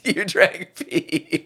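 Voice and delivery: laughing while teasing. Real, not acted. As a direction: giggle it out, amused. Teasing friend about drinking pee.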